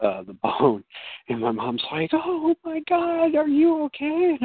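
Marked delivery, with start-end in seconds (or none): 2.1-4.4 high, drawn-out worried mom voice; alarmed mom. Retold or imitated. Mom checking on her injured child, alarmed. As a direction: imitate a fretting mother, pitched way up.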